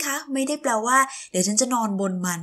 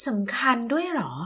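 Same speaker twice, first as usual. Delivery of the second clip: ordinary talk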